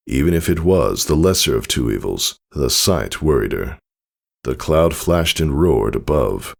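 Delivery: ordinary delivery, nothing marked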